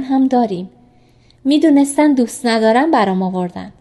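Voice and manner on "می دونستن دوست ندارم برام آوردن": usual speaking voice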